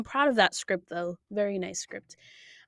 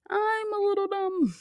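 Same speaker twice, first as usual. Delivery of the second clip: squeaky self-mock; 12 semitones higher than usual. Real, not acted. Self-mocking repeat.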